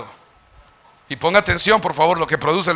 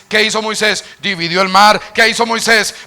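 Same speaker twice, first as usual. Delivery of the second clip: rising, ever louder sermon litany, high by the end; preaching building to full force; 7 semitones higher, 8 dB louder than usual. Acted. Preacher listing Moses' miracles, building from firm to shouting. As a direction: build the list to a climax.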